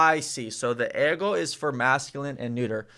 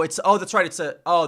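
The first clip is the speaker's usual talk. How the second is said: ordinary delivery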